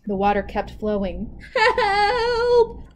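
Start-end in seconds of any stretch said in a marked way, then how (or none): 1.5-2.9 high, loud cry; crying for help. Acted. Anansi afloat in the river, desperate cry. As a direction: call out desperately. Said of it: "cried Anansi".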